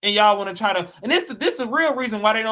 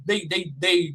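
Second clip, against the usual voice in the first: usual speaking voice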